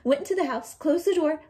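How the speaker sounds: plain reading voice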